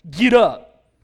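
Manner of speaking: a loud, barked wake-up order; booming angel order; loud command. Retold or imitated. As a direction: shout it like an alarm clock. Angel as alarm clock.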